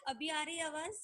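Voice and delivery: faint, distant voice over a weak mic; speaking far from the microphone; barely audible. Real, not acted. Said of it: "लेकिन आवाज बहुत कम है".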